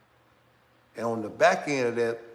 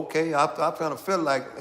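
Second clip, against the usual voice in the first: normal talking voice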